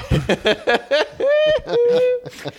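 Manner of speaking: shrill, cartoonish woodpecker cackle, very high; Woody Woodpecker laugh. Real, not acted. Laughing at the movie joke with friends: a very high cartoon woodpecker cackle. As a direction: let rip a cartoon bird laugh. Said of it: "What was, what was that laugh?".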